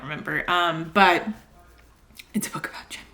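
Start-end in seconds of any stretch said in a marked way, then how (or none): 2.3-3.1 hushed, unvoiced whisper; whispered aside. Real, not acted. Telling viewers what the book is about, whispered. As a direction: drop to a whisper as if confiding.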